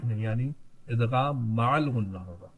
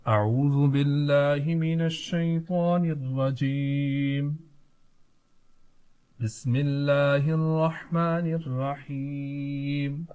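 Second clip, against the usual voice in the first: slow, measured recitation; slow chanted Arabic recitation; Quranic recitation; 4 dB louder, much slower than usual. Shown as a demonstration. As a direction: recite it slowly for students to follow. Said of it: "मेरे साथ पढ़िएगा".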